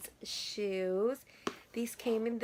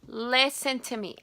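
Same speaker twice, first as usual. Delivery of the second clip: Listen said firmly and louder, falling; louder insistence; 3 semitones higher, 7 dB louder than usual. Real, not acted. Vlogger stopping viewers to confess, insistent. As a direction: demand their attention.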